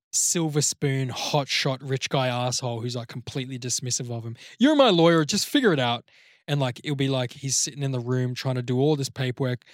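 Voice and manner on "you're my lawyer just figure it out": louder, higher mock demand; arrogant client yell. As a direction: bark at the lawyer like a spoiled rich guy. Rich client bossing his lawyer.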